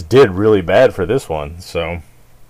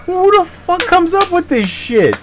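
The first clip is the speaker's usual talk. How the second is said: high, disbelieving squeal of a question; incredulous outburst; 3 dB louder than usual. Retold or imitated. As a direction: shriek it in mock disbelief. Exasperated quote of his own reaction.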